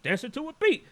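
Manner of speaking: high, hammed-up old-school hip-hop voice; mock old-school rapper. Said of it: "hip-hop was like".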